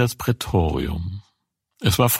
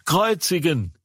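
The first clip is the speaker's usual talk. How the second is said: pitched-up cry of a crowd, read not shouted; higher mock crowd cry; 8 semitones higher than usual. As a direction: call for the cross in a raised reading voice. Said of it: "schrien sie".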